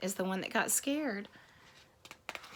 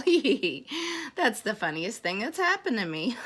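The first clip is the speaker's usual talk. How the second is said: acted storybook giggle; giggle, then talk through the amusement; giggling; 2 semitones higher than usual. Elephant amused by what happened, acted giggling. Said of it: "He began to giggle".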